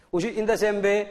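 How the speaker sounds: ordinary talk, nothing marked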